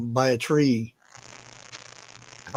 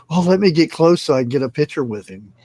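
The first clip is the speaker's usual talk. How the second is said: mocking tourist; 3 semitones higher, 7 dB louder, faster than usual. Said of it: "and people".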